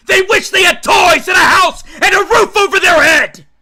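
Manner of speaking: furious high shout at his daughter; father screaming at his eleven-year-old daughter; screaming in rage. Acted. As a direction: scream it down at her, losing all control. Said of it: "he starts screaming".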